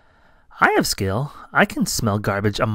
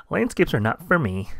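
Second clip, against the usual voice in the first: plain reading voice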